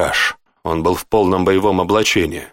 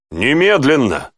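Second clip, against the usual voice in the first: slow, higher-pitched command; barked order; 5 semitones higher than usual. A narrator's reading. Lieutenant hurries the guard along, mildly barking. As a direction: drive the order home firmly. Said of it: "рявкнул Тьерри".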